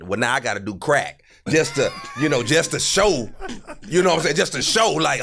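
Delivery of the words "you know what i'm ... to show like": ordinary delivery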